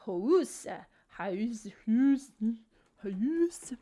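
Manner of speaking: loud, rising, baffled repeats of house; mock baffled loud house, house; puzzled repetition. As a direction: say house over and over as if it suddenly sounds strange. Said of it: "suddenly you're like".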